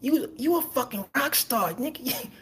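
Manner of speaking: high, incredulous mock protest; disbelieving, voice way up; mock disbelief. Reacting to Travis at dinner, high incredulous. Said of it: "I'm like".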